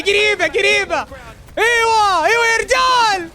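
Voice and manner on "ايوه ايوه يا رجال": high, loud drawn-out cheer; jubilant shout. Real, not acted. Streamer roaring for his player after a big play, loud and high. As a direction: roar approval at the play.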